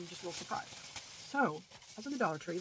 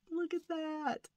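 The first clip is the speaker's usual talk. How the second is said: high-pitched, delighted squeal of words; gushing delight; 9 semitones higher, much slower than usual. Real, not acted. Showing viewers the little bunny butts, gushing delight. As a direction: gush in a high, drawn-out coo.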